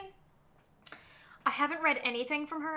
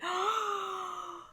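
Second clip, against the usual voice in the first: high gasp of delight; 7 semitones higher than usual. Real, not acted. Vlogger reacts to a pirate fantasy, high gasp. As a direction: gasp as if you can't believe it.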